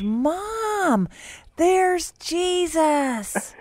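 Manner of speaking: put-on very high, drawn-out child's cry; retold high-pitched child's wonder; little daughter. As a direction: imitate an amazed small girl, slowly. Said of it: "and then she goes".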